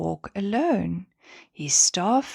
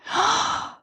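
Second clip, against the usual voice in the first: high sharp group gasp; stars gasping. Acted. The stars hear about the moon ride and gasp high. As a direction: gasp in shock like tiny stars. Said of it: "The stars gasped".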